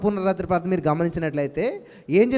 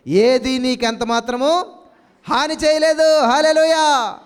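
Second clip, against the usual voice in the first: loud, higher, slow and ringing, shouting hallelujah; full-force preaching; 3 semitones higher, 5 dB louder, much slower than usual. Acted. Pastor rousing his congregation with louder, higher preaching. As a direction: preach it out to the church with rising fervour.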